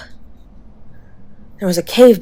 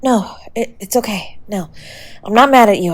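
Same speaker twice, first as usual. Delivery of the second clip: usual speaking voice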